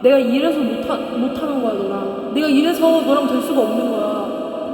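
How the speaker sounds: hurt, furious breakup cry; mock dramatic sob-shout; tearful outburst. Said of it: "금성재가 울면서".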